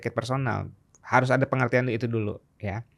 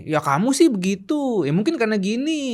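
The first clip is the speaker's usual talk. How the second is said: higher, mock blaming tone; judging partner; 7 semitones higher than usual. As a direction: play the partner who judges and blames. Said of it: "yang salah ya itu ngejudge".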